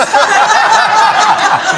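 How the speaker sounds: high loud laugh; burst of laughter. Real, not acted. Remembering the bank balance, loud laugh. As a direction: let the laughter out.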